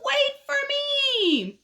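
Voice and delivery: very high, drawn-out call; eager caterpillar. Acted. Caterpillar calls after the others, eager. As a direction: stretch it out high, calling after them. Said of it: "So he says".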